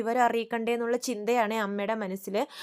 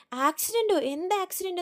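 higher, alarmed disbelief; shocked, tense; 8 semitones higher than usual. Retold or imitated. Daughter hearing of her son's accident, shocked and higher. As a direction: gasp out the question in alarm. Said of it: "ശ്രുതി ഭയങ്കരമായിട്ട് tension ആവുവാണേ".

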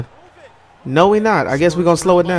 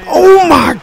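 surprised exclaim; 12 semitones higher, 5 dB louder than usual. Real, not acted. Reacting to his own dunk.

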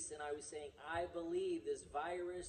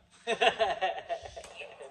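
laugh; 9 dB louder than usual. Real, not acted. [name] walked into the shot.

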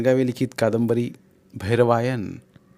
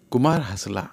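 usual speaking voice

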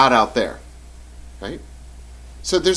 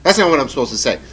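usual speaking voice

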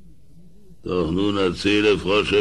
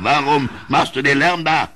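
aufgebracht; 6 semitones higher, 4 dB louder, faster than usual. Acted. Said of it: "Aufgebracht wandte sich der König".

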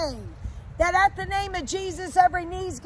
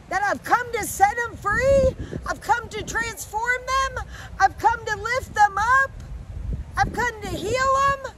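high, tearful plea with lifted line endings; tearful pleading God; 5 semitones higher than usual. Acted. God sending someone to tell his children of love, tearful pleading. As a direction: plead through tears as God, voice pitched up. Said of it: "And he's crying".

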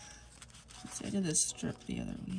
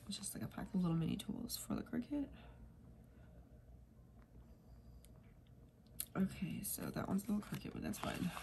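whisper; 10 dB quieter than usual. Real, not acted. Aside while placing stickers.

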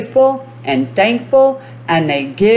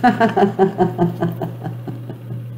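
soft laugh; 5 dB quieter than usual. Real, not acted. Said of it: "I command you to laugh".

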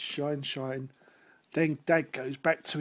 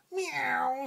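cat meow; 8 semitones higher than usual. Acted. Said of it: "sang the cats".

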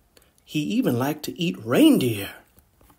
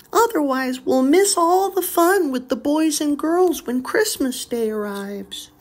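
much higher doll voice; little doll voice; 11 semitones higher than usual. Acted. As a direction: plead sweetly like a toy doll. Doll continues.

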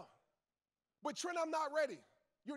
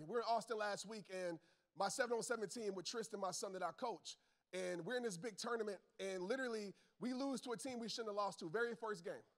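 ordinary talk

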